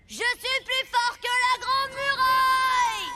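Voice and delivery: slow, loud shout at the wall; slow proud shout. Acted. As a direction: yell it out to the echo. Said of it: "Plus tu crieras fort".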